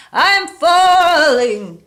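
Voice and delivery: high, slow, loud wailing warning; frightened ghostly cry. A narrator's reading. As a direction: cry out like a ghost scared of falling. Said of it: "sounding shaky and frightened".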